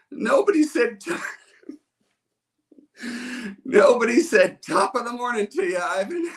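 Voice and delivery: put-on high wailing child voice, laughing through it; kid complaining. Ivan explaining his tantrum, high childish whine. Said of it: "And he goes".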